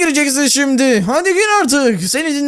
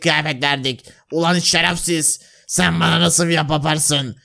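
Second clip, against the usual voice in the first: menacing guard; 8 semitones lower than usual. Acted. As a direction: talk down to the slave coldly. Plays the king's guard. (köleye tehditkâr, alçak sesli muhafız)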